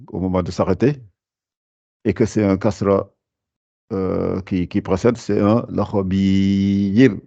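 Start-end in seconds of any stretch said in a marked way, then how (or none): 5.7-7.1 lakhabir said slowly with a thin, light ra and the tongue forward; thin ra demo. Shown as a demonstration. Teacher showing students the thin ra, slow careful demo. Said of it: "je, j'amincis le ra".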